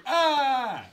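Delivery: high loud mock scream, laughing; mock electrocuted scream. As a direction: scream like being zapped. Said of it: "That was just him going".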